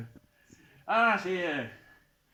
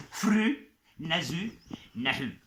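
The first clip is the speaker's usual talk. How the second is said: slow teaching take; much slower than usual. Shown as a demonstration. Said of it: "Vous êtes prêts?".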